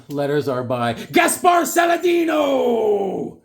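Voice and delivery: slow, loud, high announcer call; announcer boom. Pretend. Reading out comic credits like a loud, slow announcer. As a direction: boom the name like a ring announcer.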